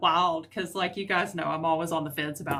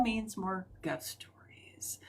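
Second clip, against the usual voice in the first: spooky whisper; 11 dB quieter, much slower than usual. Acted. Spooky teaser to viewers.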